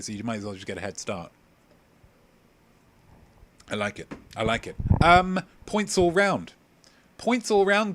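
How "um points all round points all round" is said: cheery announcement. Acted. Quizmaster cheering everyone.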